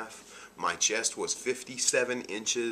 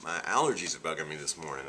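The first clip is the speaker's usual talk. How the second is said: ordinary talk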